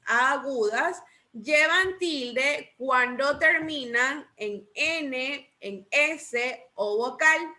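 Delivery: very slow, stretched-out teaching repetition; slow rule recital. Shown as a demonstration. Teacher drills the accent rule with the class, very slow and deliberate. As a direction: spell out the rule slowly, drawing out key words. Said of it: "Repitamos esta regla".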